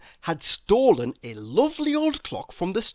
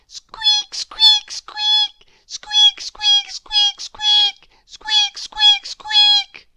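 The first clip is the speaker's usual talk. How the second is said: very high squeaks, louder and urgent; frantic mouse squeaks; 9 dB louder, much slower than usual. A narrator's reading. Mouse squeaking loudly at the sleeping man. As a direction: squeak desperately to wake him.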